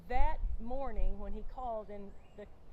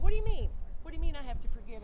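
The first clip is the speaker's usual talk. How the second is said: high, loud burst, then quick repeat; talking back in mock protest; flippant; 5 semitones higher, 8 dB louder, faster than usual. Daughter answering the Lord back, flippant. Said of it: "I was flippant with the Lord".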